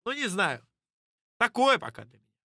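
normal talking voice

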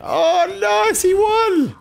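high-pitched cry of delight; real thrilled outburst; excited cheer. Real, not acted. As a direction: cheer the win, pitch leaping way up. Cheering a win in the game.

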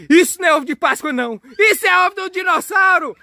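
high, shouted alarm; mock panic. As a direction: blurt the discovery in hammed-up high-pitched panic. Panicked discovery of dinosaur egg. (pânico fingido, gritado e agudo)

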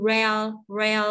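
"rel" with curled R and a clear final L; careful, separate "rel"; tongue-tip L. Shown as a demonstration. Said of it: "âm L đẩy đầu lưỡi".

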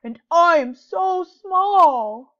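a wolf mourning how small he is; long howling vowels, pushed loud; howled. A narrator's reading. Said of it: "howled Wolf".

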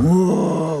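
overwhelmed whoa. Retold or imitated. Overwhelmed by clemency news.

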